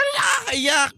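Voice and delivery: a sudden high mocking yell; mocking shout. Vlogger jeering at a crying witness, mocking shout.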